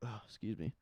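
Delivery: hiccuping mid-word; hiccups. Real, not acted. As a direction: catch a hiccup, then apologize. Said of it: "Got the hiccups".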